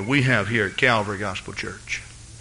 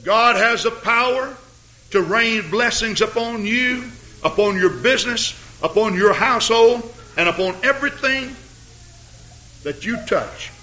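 much higher, ringing preacher's crescendo; preaching at full force; 10 semitones higher than usual. Acted. Preacher lifting the congregation on God's power, full-force preaching. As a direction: let the blessings pour out, climbing.

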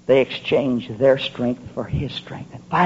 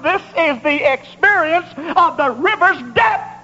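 high, driving, impassioned revival sermon; full-force preaching; 14 semitones higher than usual. Real, not acted. Preacher crying for the congregation in the river, impassioned. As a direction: preach relentlessly, climbing in fervor.

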